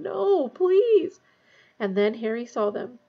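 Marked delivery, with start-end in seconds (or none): 0.0-1.2 high, loud pleading moan; moaned plea. A narrator's reading. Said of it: "he moaned".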